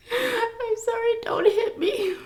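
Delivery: high, mock-frightened whimper; mock cowering. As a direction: play scared, begging not to be hit. Mock cowering after scolding.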